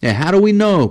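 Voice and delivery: loud, high retort; heated outburst; angry cry. Acted. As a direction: shout back in exasperation. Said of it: "cried Travis".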